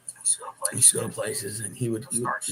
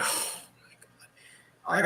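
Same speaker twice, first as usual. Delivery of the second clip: slow, breathed-out oh my God; exasperated whisper; 3 semitones higher, 6 dB quieter, much slower than usual. Retold or imitated. Banned from the bar for nothing, slow exasperated whisper. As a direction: whisper it in weary disbelief. Kicked out despite innocence.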